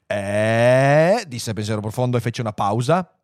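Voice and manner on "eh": long, loud drawn-out word; suspenseful computer. Acted. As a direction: stretch the word to build suspense. (pausa solenne e prolungata)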